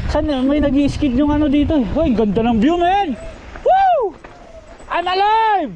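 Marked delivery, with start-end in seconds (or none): 3.6-5.7 thrilled shout. Real, not acted. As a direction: shout it out with joy. Thrill at bottom of trail.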